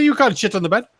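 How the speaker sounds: high mock accent for the receptionist; Italian accent. Receptionist refusing the tourist, high mock accent. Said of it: "the Italian".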